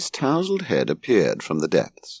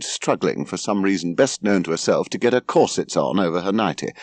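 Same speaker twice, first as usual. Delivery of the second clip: ordinary delivery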